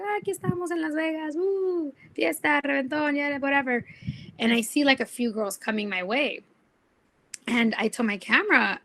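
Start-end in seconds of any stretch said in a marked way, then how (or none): 0.0-3.8 imitating her younger self, higher, bubbly party host in Spanish; hammed-up Vegas show opener, tossed off at the end; mock hype host. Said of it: "and I was like".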